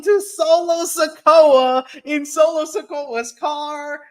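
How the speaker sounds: high, loud, drawn-out exclamation; excited announcer-like climax; dramatic reveal. Real, not acted.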